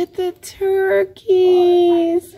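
slow, high, thrilled; high and drawn out with delight; excited. Real, not acted. Excited about baby turkeys.

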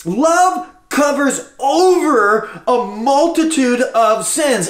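slow deliberate reading of the verse; slow weighty scripture; solemn scripture. Acted. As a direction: draw out each word reverently. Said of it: "he's going".

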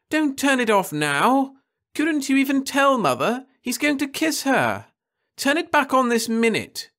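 put-on high, loud pleading teenage voice; a spoiled nineteen-year-old whining at her mother; petulant girl. A narrator's reading. Daughter begging mother to turn the wall back on, high and whiny.

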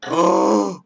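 loud, high gasp; sharp gasp. Acted. Player reacting to the game, shocked gasp.

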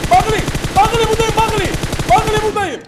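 loud, high call across the map; excited loud calling out. Real, not acted. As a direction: shout his name to get attention. Calling out to a teammate.

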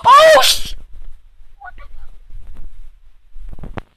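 loud high cry of surprise; startled yelp. Real, not acted. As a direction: burst out at the lucky pull. Packs a legend.

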